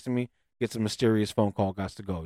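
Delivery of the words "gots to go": low, soft mock getaway line; retold hushed exit; muted excuse. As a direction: slip out with a quiet excuse. Retold stranger's muted excuse to leave.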